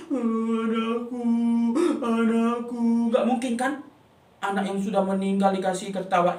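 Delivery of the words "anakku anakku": slow, sung-out wail of grief; keen over a lost child; mother mourning her dead daughter, wailing. Acted. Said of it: "ibunya sudah menangis".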